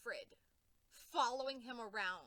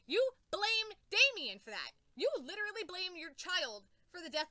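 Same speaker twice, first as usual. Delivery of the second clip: high, loud accusing repeat; furious accusing; 5 semitones higher, 7 dB louder than usual. Real, not acted. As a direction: hammer the accusation. Accusing Batman.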